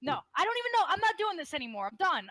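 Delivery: loud, high fed-up outburst; exasperated outburst. Real, not acted. Quitting the argument with Tasteless, a loud fed-up outburst. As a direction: throw up your hands and quit. Gives up on arguing.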